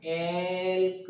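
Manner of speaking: Elk stretched out slowly; elk said very slowly for the class; slow, drawn-out naming. Shown as a demonstration. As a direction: draw out elk syllable by syllable. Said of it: "तो बोल दो, ये क्या है?".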